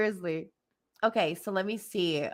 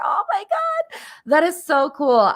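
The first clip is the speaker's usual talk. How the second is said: high squeal of joy, then louder gushing; real delight; 9 semitones higher, 5 dB louder than usual. Real, not acted. Reader thrilled by Brenda's message, delighted gushing. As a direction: gush with happy amazement, starting very high. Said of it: "that makes me so happy".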